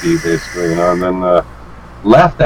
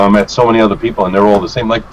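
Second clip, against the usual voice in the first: normal talking voice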